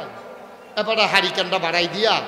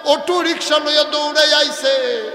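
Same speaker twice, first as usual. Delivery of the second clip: higher, louder sermon delivery; impassioned preaching; 9 semitones higher, 8 dB louder than usual. Acted. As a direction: keep the pulpit fire going. Fervent preaching narration.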